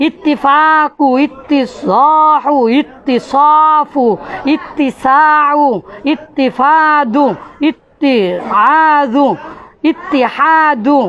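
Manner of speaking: each ইত্তি word read slowly and distinctly; slow word list. Shown as a demonstration. Teacher reads a list of examples to students, slow. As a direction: recite the examples one by one, slowly. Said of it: "দেখেন".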